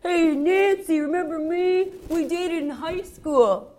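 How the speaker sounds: loud, high shout across the street, slowing on the last words; yelling construction worker. Retold or imitated. Construction worker shouts to his old flame Nancy, loud yell. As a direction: holler it like a guy calling from a building site. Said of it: "a construction worker yells".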